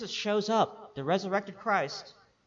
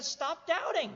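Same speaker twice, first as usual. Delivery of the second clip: higher-pitched acted order; emphatic command; 9 semitones higher than usual. Preacher quotes Jesus correcting Thomas, emphatic command. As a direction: give the order at normal volume, pitched up.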